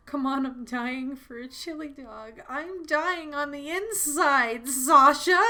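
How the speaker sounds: high, slow, breaking wail; hammed-up anguish. Mishka covering heartbreak, voiced as a high hammed-up wail. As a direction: cry out the name like a fake breakdown. Voicing Mishka's forced cheer.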